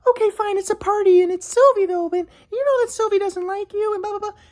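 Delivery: high falsetto, exasperated; mocking his ex. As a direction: give in with a high annoyed whine. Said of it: "And she's like".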